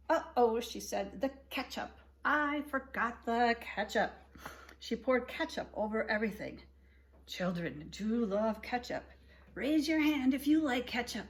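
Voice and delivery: gentle read-aloud for little listeners; storybook reading. A narrator's reading. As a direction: read it like bedtime story time. Reading picture book to kids.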